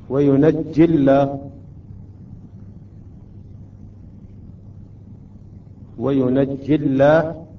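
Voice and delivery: وينجي الله joined slowly, with a thin lam in الله; light lam. Shown as a demonstration. Teacher showing the lam after kasra, slow light-lam recitation. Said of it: "هنا أيضًا ترقق اللام".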